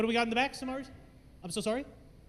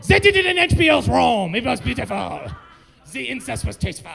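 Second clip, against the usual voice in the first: put-on French accent; 2 semitones higher, 6 dB louder than usual. Comedian defending HBO's Rome as beautiful, French accent. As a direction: burst out in mock-French, then settle. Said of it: "go back to doing French".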